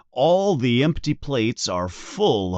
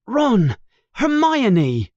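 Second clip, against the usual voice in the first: higher, louder urgent call; sudden alarm; 11 semitones higher, 4 dB louder, slower than usual. Acted. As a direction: blurt out the realization. Harry suddenly remembers.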